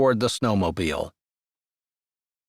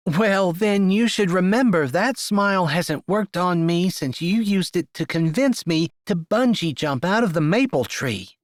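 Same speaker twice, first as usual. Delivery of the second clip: put-on higher, teasing young woman voice; sassy young woman; 7 semitones higher than usual. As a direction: voice her like a sharp-tongued friend. Harper's lines, narrator voices woman.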